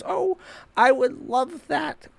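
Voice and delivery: put-on high, slow, sweet little-old-lady voice; delighted grandma. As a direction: coo it like a thrilled grandma. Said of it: "grandma goes".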